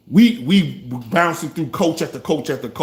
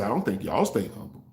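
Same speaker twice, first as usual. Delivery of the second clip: quiet jab; 5 semitones lower, 8 dB quieter than usual. Real, not acted. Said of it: "did y'all stay humble?".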